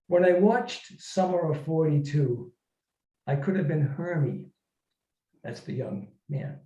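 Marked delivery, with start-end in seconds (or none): 0.1-4.5 earnest, measured reading voice; story reading. Acted. Author reading his letter story to the audience, earnest and measured. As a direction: read it like a heartfelt letter.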